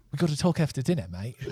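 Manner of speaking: put-on comically off-target Australian accent; botched Australian accent. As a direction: attempt a Scot and slip into bad Australian. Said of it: "I'm Australian".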